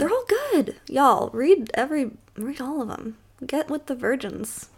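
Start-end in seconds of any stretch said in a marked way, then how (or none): 0.0-0.8 squeaky high echo of They're all good; playful high-pitched echo; high mock echo. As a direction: parrot it back way up high. Echoing her mock-plea.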